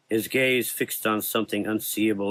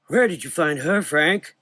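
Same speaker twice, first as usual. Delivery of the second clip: put-on high, curious old man; 7 semitones higher, 3 dB louder than usual. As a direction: ask with delight. Old doctor asks.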